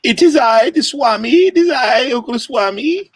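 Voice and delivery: put-on high-pitched hammy swami accent; Zoltar. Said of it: "That's my Zoltar impersonation".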